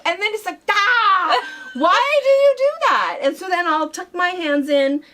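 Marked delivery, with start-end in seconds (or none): 0.7-3.2 much higher, louder mock pained cry; pained complaint. Owner retelling her complaint to the scratching cat, mock pained cry. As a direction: whine at the cat who scratched you.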